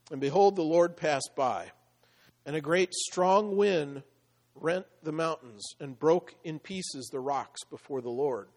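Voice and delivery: loud, measured Bible reading; scripture reading. Acted. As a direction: proclaim the verses to the congregation. Reads scripture aloud.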